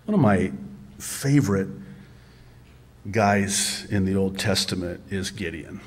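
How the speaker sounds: ordinary delivery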